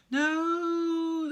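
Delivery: high, loud terrified scream. Acted. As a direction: scream as the net falls. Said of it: "they screamed".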